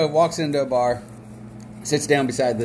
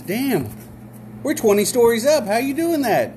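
much higher, retold astonished disbelief; amazed; 10 semitones higher than usual. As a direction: play the stunned bar patron, pitch jumping up. Joke: amazed barfly asks again.

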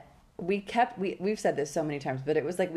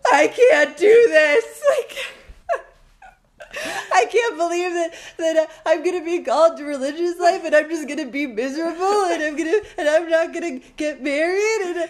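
loud, high, hammed-up wailing through laughter; mock meltdown; 11 semitones higher, 10 dB louder than usual. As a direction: wail it like a comic total breakdown, gasping. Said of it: "we would be in shambles".

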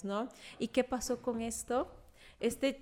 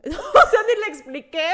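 loud laughing words, higher; 7 semitones higher, 14 dB louder than usual. Real, not acted. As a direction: laugh through it. Laughs retelling the snub.

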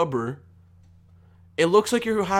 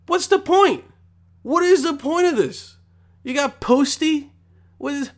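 exasperated rant; 10 semitones higher, 4 dB louder than usual. Real, not acted. Ranting about [name] Crocs.

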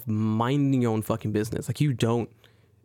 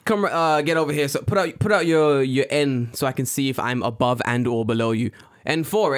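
mock official challenge; gatekeeper cop; 2 semitones higher, 3 dB louder than usual. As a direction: play a self-appointed policeman giving orders. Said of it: "how I see gatekeepers in Japan".

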